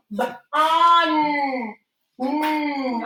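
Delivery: ordinary delivery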